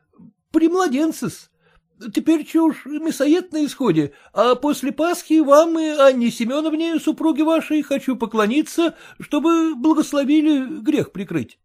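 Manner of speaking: bow and scrape to the master; humble clerk. A narrator's reading. Clerk's voice in story.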